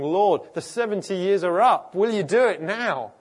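loud, high plea to God; urgent prayer. Acted. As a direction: cry out boldly, demanding an answer. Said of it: "began to pray, saying".

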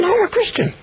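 high mimicking of the earnest friend; imitating mate. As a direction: parrot the mate in a silly high voice. Mate insists again.

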